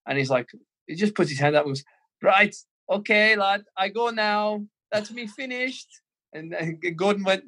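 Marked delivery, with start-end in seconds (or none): 2.2-5.9 put-on slow, much higher Scouse German accent, casual; imitating Gravesen's Scouse German accent, calmly leaving. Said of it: "he's got this weird Scouse German accent going on".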